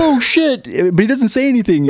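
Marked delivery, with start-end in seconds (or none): 0.0-0.6 high-pitched mock alarm; sudden high yelp of dismay; panicked exclamation. Acting out panicked man on raft.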